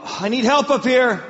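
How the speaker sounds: loud, high-pitched shout for help; wife's yell. Retold or imitated. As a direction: yell urgently as a wife calling for backup. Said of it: "I hear my wife yell".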